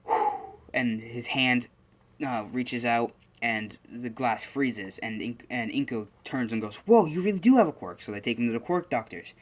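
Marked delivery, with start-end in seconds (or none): 0.0-0.3 imitated blowing. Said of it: "just goes". 6.9-8.0 high, loud astonished outburst; amazed. Retold or imitated. Acting mother's amazement at freezing.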